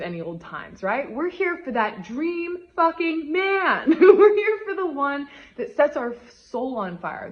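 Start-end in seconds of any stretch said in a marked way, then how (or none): 1.1-3.9 much higher, louder and slow, leaning on every word; rally the listeners, hype them up; punchy pep-talk declaration; fired-up emphasis. Real, not acted. Rallying listeners toward dream man. 3.9-7.3 loud and higher, laughing through the words; giddy, real laughter while speaking; laughing excitedly. Real, not acted. Laughing after her own swear.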